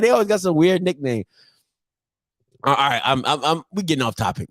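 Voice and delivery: ordinary talk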